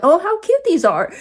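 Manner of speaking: forced brightness after a shock; forced cheer. Retold or imitated. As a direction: cheer yourself up over cute toys. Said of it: "try to cheer myself up".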